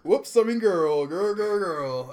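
high, loud playful correction; sing-song it playfully. Real, not acted.

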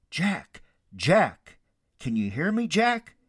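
lightly acted call to someone below; searching call with space between shouts. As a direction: call the name, checking for an answer. Unseen voice calls down to Jack.

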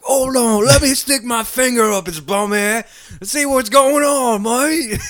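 put-on high-pitched Australian accent; crocodile hunter. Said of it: "be like the crocodile hunter".

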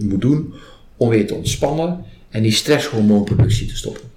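ordinary talk, nothing marked

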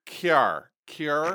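Kiar with a nasal KYAR vowel. Shown as a demonstration. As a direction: imitate the Central New York car. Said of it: "like a high nasal vowel sound".

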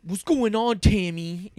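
mock tough-guy taunt; jokey teen movie jock voice; mock teen movie dude. Mocking teen movie dialogue.

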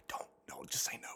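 fast urgent whisper. Retold or imitated. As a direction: whisper a frantic warning. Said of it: "being like".

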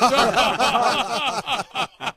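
a real burst of high laughter breaking off the quote; hearty laugh. Real, not acted. Telling radio hosts about Carlisle, bursting into laughter.

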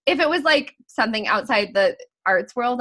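ordinary delivery

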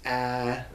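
"a" as the vowel in bat; short A. Shown as a demonstration. Teacher gives the right sound, short "a" as in bat. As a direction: say "a" like the vowel in cat. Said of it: "like the A in bat".

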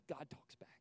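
soft whispered revelation; hushed awe. Real, not acted. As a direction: drop to a secret whisper. Revealing key point.

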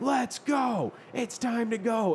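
high, pushy call to leave, dragged out; impatient yell. Retold or imitated. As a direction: pull him away with your voice. Wife screaming to leave.

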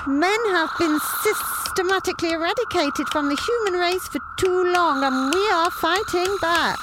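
high, haughty tyrant's speech; pompous villain. A narrator's reading. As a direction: lecture them like a gloating dictator. Villain chief's long speech.

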